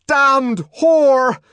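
high, loud bellow of rage; furious shout. A narrator's reading. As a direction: hurl it as a shouted insult. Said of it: "he shouted".

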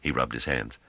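plain reading voice

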